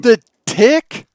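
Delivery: high, loud, disbelieving yelp; incredulous shout. Real, not acted. Disbelief at friend's wrong guess.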